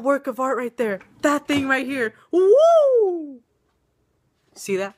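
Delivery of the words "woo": a high, loud whoop of pride; excited cheer. Real, not acted. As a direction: cheer for your own work. Proud cheer over her drawing.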